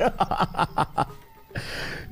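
high-pitched, laughing through the words. Real, not acted. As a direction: break up laughing at your own failure. Laughs at own repeated failed robberies.

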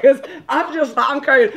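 high-pitched, giggly speech; laughing. Real, not acted. Host loses her thread over the dance talk, laughing. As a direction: struggle to talk through real laughter.

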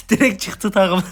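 bursting into laughter, high and loud; laughing. Real, not acted. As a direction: laugh the words out. (kahkahayla gülerek)

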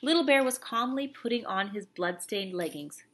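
usual speaking voice

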